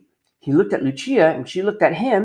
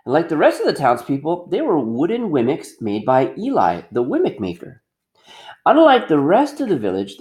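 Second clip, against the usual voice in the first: ordinary delivery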